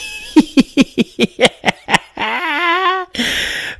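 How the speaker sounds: sudden high-pitched laugh; high burst of laughter. Real, not acted. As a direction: break into helpless laughter. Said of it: "I'm crying of laughter".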